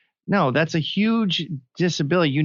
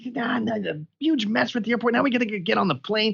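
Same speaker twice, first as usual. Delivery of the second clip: mock scolding father, pitched up high; retold angry father; 9 semitones higher than usual. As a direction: imitate a dad barking at his kid in an airport stall. Father scolding son in stall.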